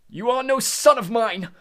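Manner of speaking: loud, high, slow furious bellow. Acted. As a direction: disown the son with rage. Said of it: "bellowed Mister Crouch".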